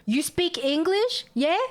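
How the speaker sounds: high-pitched mock questions, each rising; condescending stranger. Rude customers coming up to ask about English, mocking condescension. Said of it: "people coming up to me and be like".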